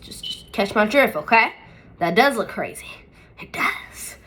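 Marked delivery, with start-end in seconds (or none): 3.4-4.2 whispered admission to herself; whispered aside. Real, not acted. Admitting to viewers the brow looks crazy, whispered. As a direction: breathe it out like a confession.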